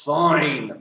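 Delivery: loud, long-held "fine"; annoyed. Shown as a demonstration. Child told by parents to clean the room, annoyed. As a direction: agree while making the irritation plain. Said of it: "like, if you're annoying".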